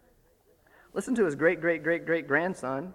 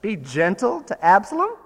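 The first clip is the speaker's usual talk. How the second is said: louder, higher disbelieving echo; incredulous; 4 semitones higher, 5 dB louder than usual. Retold or imitated. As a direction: throw the words back in disbelief. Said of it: "what Joab must have thought".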